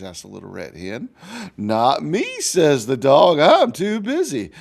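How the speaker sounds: put-on lively storybook narration for kids; reading to children. A narrator's reading. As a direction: read it like a picture book to a class. Teacher reads story to children.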